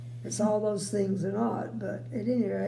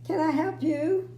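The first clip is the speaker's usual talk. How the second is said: put-on sweet old lady; 7 semitones higher, 5 dB louder than usual. A narrator's reading. Sales lady offers help.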